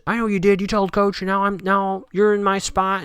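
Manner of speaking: a touch of a jealous teammate's fury; mock angry rival. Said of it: "And she's really mad".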